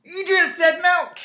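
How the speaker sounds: high, loud, drawn-out protest; slow it down and pitch it way up, loudly; exasperated accusation. Real, not acted. Co-host catching partner mispronouncing milk, exasperated. Mock quarrel over pronunciation.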